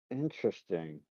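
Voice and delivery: soft, thoughtful aside; musing. Acted. Prisoner adding things together in his mind, quietly musing. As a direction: murmur it as the pieces click together. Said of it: "like he's adding things together in his mind".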